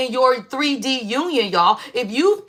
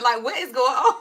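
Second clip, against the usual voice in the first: laughing. Retold or imitated. Telling viewers her bath story, laughing mid-line. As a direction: quote laughing through the words. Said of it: "I'm like".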